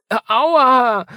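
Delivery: high, loud drawn-out yelp; child's wail of hurt and shock; pained cry. A narrator's reading. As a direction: cry out in startled pain. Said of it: "schrie Marie auf".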